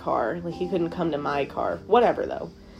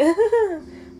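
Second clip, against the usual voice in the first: a short high nervous giggle; high awkward giggle; 13 semitones higher than usual. Retold or imitated. Pulling away from the bad kiss, high awkward giggle. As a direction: laugh it off awkwardly. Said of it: "I literally giggled like that".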